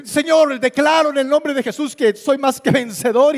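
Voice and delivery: high, loud hammed-up declaration of victory; imitating a churchgoer's loud fervent prayer; mock fervent prayer. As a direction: play the over-the-top believer proclaiming victory. Churchgoer praying in trance.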